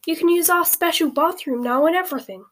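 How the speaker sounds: put-on high, loud, delighted girl's voice; happy cry. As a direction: burst out with joy. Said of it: "cried Hermione happily".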